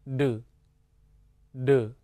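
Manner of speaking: short "de" syllable, said twice with a pause; syllable demonstration. Shown as a demonstration. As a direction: model "de" crisply for learners to repeat. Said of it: "读作".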